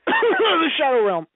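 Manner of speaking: high, drawn-out words through a cough; words disguised as coughing; fake cough. Jokes the words inside a cough.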